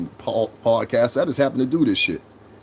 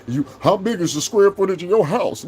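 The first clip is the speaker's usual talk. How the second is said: higher mock of a nosy old viewer; 5 semitones higher than usual. Mocking a sixty-year-old commenter's question, higher mock voice. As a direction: parody the house-size question.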